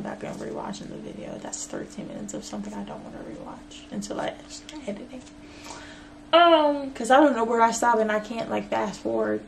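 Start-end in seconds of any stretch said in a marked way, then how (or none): 0.0-5.2 under breath. Real, not acted. Aside muttered under breath.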